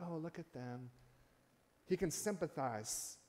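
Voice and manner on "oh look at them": a soft, condescending pity, much softer; patronizing. Retold or imitated. Preacher mimics a condescending onlooker, soft and patronizing. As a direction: sigh it down at them like they're beneath you. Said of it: "not in a patronizing, condescending way".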